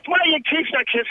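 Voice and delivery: ordinary talk, nothing marked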